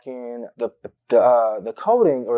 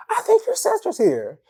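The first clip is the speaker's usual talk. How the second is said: put-on surprised boyfriend. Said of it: "He's like".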